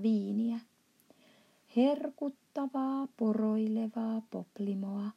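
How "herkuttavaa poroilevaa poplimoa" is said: plain reading voice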